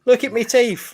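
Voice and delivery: bad English accent. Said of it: "appalling attempt at an English accent".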